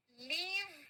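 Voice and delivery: लीव with a long drawn ee; long-ee leave. Shown as a demonstration. Teacher leading a pronunciation drill, लीव with long ee. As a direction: stretch the vowel of लीव. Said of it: "मेरे साथ में इसका pronounce".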